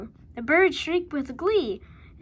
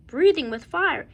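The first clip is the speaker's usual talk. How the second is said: ordinary delivery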